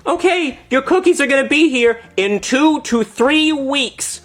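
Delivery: put-on high, drawn-out little girl voice; Girl Scout. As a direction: imitate a child slowly announcing it up high. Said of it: "the Girl Scout says".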